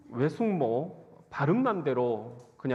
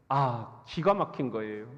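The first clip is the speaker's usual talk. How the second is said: normal talking voice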